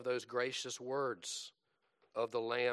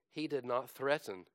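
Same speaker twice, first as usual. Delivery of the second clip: normal talking voice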